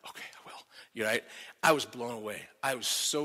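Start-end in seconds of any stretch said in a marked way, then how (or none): 0.1-0.6 tiny breathless whisper; relieved whispered reply. Retold or imitated. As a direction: barely get the words out. Meek reply to the cop.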